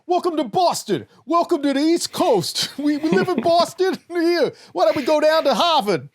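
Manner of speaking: put-on broad jokey Boston accent, dropped r's; mock Boston accent. Host greeting co-host in mock Boston accent. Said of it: "your Boston accent".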